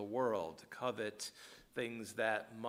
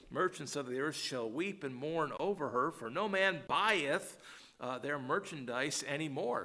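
louder, higher, lamenting King James reading; solemn scripture reading; 5 semitones higher, 4 dB louder than usual. Acted. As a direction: give the alas lines full weight. Reads scripture aloud.

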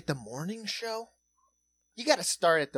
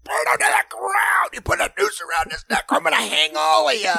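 high, loud, hammed-up rage; mock fury; 11 semitones higher, 6 dB louder than usual. Imitating the anchor raging over Smollett, high hammed-up fury. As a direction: rant it like a preacher calling for a hanging. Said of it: "He's like".

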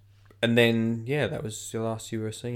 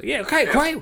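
high, loud mock Australian radio host; 8 dB louder than usual. Radio DJ greeting guests on air, mock Australian accent. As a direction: put on a chirpy Australian presenter, pitched right up. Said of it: "I don't know why he's".

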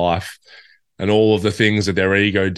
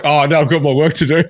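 high, loud mock dismay; 7 semitones higher, 6 dB louder than usual. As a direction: whine it out in fake dismay. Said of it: "Then you're like".

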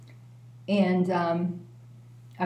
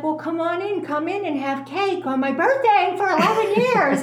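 high, sing-song welcome, much higher; sugary neighbor; 10 semitones higher than usual. Retold or imitated. Neighbor sweetly inviting the newcomer in, high and sing-song. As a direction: coax her in with a sugary high lilt.

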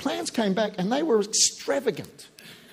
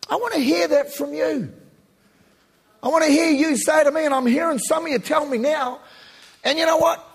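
preaching at full force; 6 semitones higher, 5 dB louder than usual. Real, not acted. Exhorting congregation loudly.

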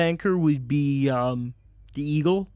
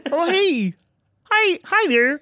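frog voice; 11 semitones higher, 4 dB louder than usual. Acted. Frog character greets newcomer.

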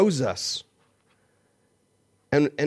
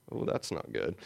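muttered dismay; 9 semitones lower, 11 dB quieter than usual. Retold or imitated. Dismay after she leaves.

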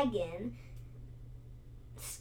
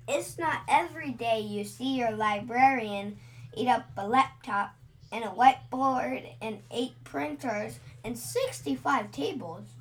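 plain reading voice

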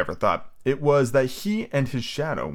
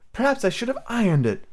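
put-on thoughtful girl voice; 13 semitones higher than usual. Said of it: "Wendy said thoughtfully".

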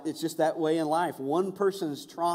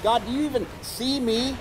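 higher, pleading call to God; mild crying out; 8 semitones higher than usual. Retold or imitated. Believer crying out to God in trouble, mild desperate plea. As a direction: cry out to God, lightly acted. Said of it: "You've cried out to God and said".

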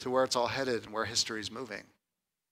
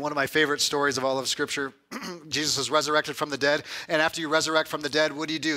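usual speaking voice

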